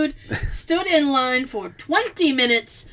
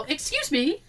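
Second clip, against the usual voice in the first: light, softer polite query; polite approach; 3 semitones higher, 5 dB quieter than usual. Retold or imitated. Couple approaching a local on the street, polite. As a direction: approach a stranger gently.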